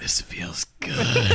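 slow, strained whisper; mock dying whisper. Comic telling of a friend's crushed moment, mock dying whisper. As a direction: whisper it slowly as the life drains out. Acting out his friend's soul leaving.

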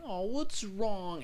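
high, slow mock concern; acted movie line. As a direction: draw it out high, playing innocent. Continues acting out the movie scene.

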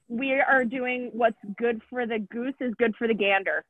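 ordinary delivery

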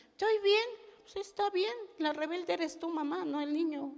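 put-on high, bright-pitched kid voice talking back; child's voice. A small child telling his mother she's the rebel, high-pitched. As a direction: answer like a small cheeky child.

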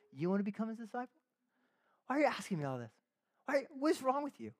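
mock-incredulous snark, pitch lifted; snarky. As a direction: act out needling the questioners. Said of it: "the man gets a little snarky".

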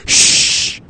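hissed shh; shushing. Retold or imitated. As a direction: shush the noisy pair. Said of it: "everyone was like".

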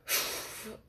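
long, breathy exhale; drawn-out sigh before carrying on; heavy sigh. Real, not acted. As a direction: blow out a weary breath. Summing up her embarrassing stories.

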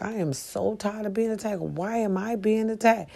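lightly voiced self-pity; imitating someone fed up and whining; whiny mock complaint. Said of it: "you just be like".

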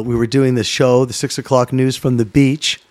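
ordinary talk, nothing marked

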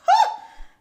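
very high loud laugh; real squeal of laughter. Real, not acted. Laughs at her own point.

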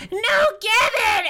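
high crying shout of grief; anguished cry. Acted. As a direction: wail the name in despair. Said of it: "and he's like".

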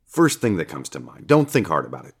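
plain reading voice

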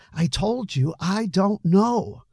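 high, drawn-out defensive insistence. A narrator's reading. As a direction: push back, dragging out the words. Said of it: "suddenly all defensive".

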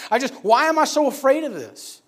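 high, loud, anguished question; exasperated plea. Retold or imitated. Boy asking God about his fear, exasperated plea. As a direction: build to a pleading outburst.

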